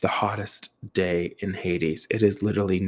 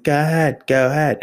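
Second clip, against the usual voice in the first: higher, louder call from behind; 7 semitones higher, 5 dB louder than usual. Retold or imitated. Maintenance man waving the van on, calling out. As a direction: holler it to the driver up ahead.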